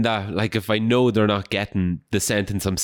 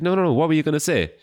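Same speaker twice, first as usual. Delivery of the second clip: eager coaxing; 8 semitones higher than usual. Retold or imitated. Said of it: "She's like".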